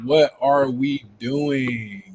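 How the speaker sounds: drawn-out, slow repeat of the question; slow echo. Real, not acted. Host echoing his co-host's disbelief, slow and drawn out. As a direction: stretch every word out.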